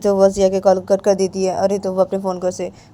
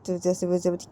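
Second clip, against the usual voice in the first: usual speaking voice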